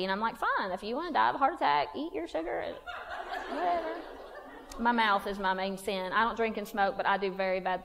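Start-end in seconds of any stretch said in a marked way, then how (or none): 0.4-3.9 mock-huffy wife; huffy retort. Wife telling husband to go ahead and eat sugar, mildly huffy. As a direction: toss it off in a mild, higher-pitched huff. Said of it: "huffy and puffy".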